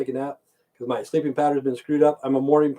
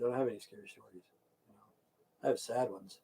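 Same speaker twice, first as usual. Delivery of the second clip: ordinary talk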